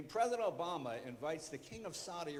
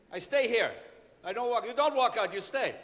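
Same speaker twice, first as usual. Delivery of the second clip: loud, higher, insistent delivery; exasperated back-and-forth; 5 semitones higher, 12 dB louder than usual. Retold or imitated. Host and director argue over the cue, loud and exasperated. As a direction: hammer each short phrase out loudly.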